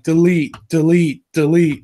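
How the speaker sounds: Matt Hardy. Retold or imitated. Podcaster quoting a wrestler's catchphrase, loud punched impression. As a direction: bark each word separately, like a wrestler. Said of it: "Matt Hardy from W W E".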